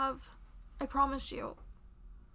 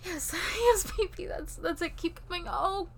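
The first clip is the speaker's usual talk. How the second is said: high, loud ecstatic cries; climactic cries; 7 semitones higher, 9 dB louder than usual. Acted. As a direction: let it break into cries of release. Climax of the scene.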